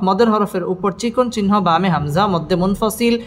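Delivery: usual speaking voice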